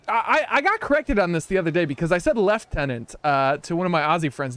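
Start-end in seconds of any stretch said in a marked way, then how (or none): none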